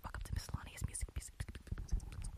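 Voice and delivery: fast, breathy ASMR whisper. Pretend. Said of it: "if I do an A S M R two-hour show".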